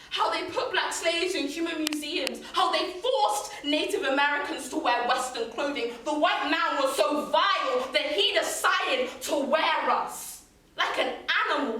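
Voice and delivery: impassioned spoken-word anger; outraged accusation. Acted. Poem condemns cruelty to enslaved people.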